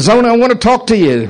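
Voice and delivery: lightly voiced father, pitched high; a hint of a father calling his daughter over; retold father.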